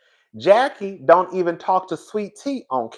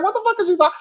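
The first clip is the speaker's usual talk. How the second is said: high-pitched baffled outburst through laughter; confused; 12 semitones higher, much faster than usual. Retold or imitated. Host retelling her reaction to Heavenly, high and confused. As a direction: squeal it, laughing in disbelief. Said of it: "I was so confused".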